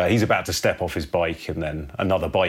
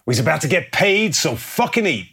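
gang boss hollering; 11 semitones higher, 7 dB louder than usual. Acted. Gang boss announcing payday to his men, loud hollering. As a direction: holler it out to the whole crew. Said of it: "And he says".

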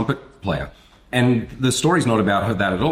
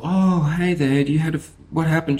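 caring doctor; 9 semitones higher than usual. Retold or imitated. Doctor greeting an injured little girl, caring and much higher. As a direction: coo gently at a hurt little girl. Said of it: "the doctor who's caring".

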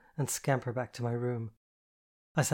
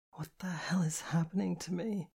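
higher, hushed, half-whispered bewilderment; a breathy, raised half-whisper to yourself; bewildered; 5 semitones higher, 4 dB quieter than usual. A narrator's reading. Tearful woman asks herself what is happening, bewildered half-whisper. Crying narrator's bewildered thought.

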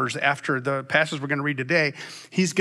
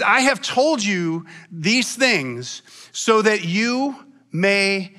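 very slow, measured Bible reading; solemn, deliberate pulpit recitation; scripture reading; much slower than usual. A narrator's reading. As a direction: spread the phrases out with weighty pauses. Reads scripture aloud to congregation.

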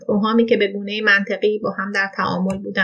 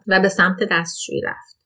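plain reading voice

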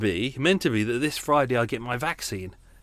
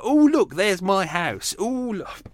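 mock posh pilot; 5 semitones higher, 5 dB louder, slower than usual. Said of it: "And they're like".

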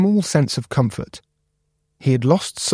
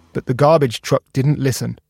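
plain reading voice

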